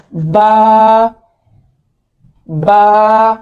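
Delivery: बा with both lips pressed together; lips closed ba. Shown as a demonstration. Teacher shows students how to say बा. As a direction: model बा, lips meeting firmly. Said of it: "दोनों होंठ आपस में मिलेंगे".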